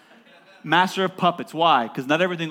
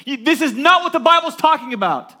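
loud, high mocking quote of critics; 10 semitones higher, 9 dB louder than usual. Preacher mocking critics' claim to the congregation, loud and high. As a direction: throw the opponents' claim back at them. Mocking the opposing argument loudly.

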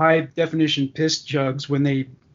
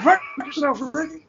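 laughing echo; 11 semitones higher than usual. Retold or imitated. Echoing the Bubbles line.

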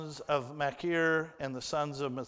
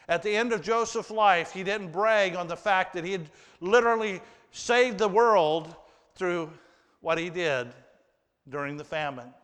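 louder, higher and driving preaching; 4 semitones higher, 6 dB louder than usual. Acted. Preacher stressing Joseph's humility to the congregation, forceful. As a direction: drive the point home from the pulpit.

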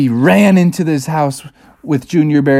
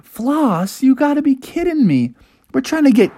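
put-on higher-pitched kid's complaint; indignant disbelief; 11 semitones higher than usual. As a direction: protest inwardly like a disappointed kid. Kids' indignant inner thoughts.

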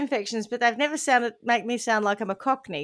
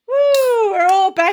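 cheering; 9 semitones higher, 10 dB louder, slower than usual. Real, not acted. Cheering together.

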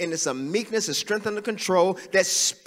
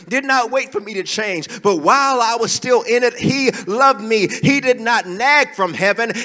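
loud, higher, rousing sermon climb; preaching at full force; 4 semitones higher, 7 dB louder than usual. Acted. Pastor rouses congregation with loud, fervent preaching. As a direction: lift the church with it.

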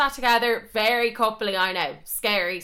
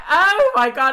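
high, loud wail of mortification; comic horror; 10 semitones higher, 10 dB louder than usual. Real, not acted. Imagining she disturbed him in bed, with a loud mortified wail. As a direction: cry out in mock agony. Dreads what she did asleep.

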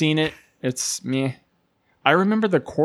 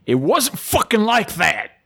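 angry outburst; 5 semitones higher than usual. Retold or imitated. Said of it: "and he says".

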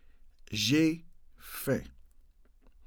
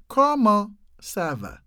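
"Comment ça va" slowly, with a gap before "ça va"; slowed-down model; 5 semitones higher, 4 dB louder than usual. Shown as a demonstration. Teacher showing learners the French, slowed down. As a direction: draw out "Comment ça va" in separate chunks.

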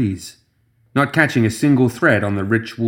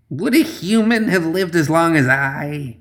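high bitter witch snarl; spat angrily; 7 semitones higher than usual. Acted. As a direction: spit the words. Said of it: "she spat".

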